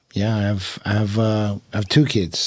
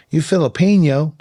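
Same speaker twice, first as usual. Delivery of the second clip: higher, louder Oklahoma cowboy voice; drawling cowboy; 7 semitones higher, 5 dB louder than usual. Retold or imitated. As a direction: play the tobacco-chewing Oklahoma local. Oklahoma equipment manager voiced in story.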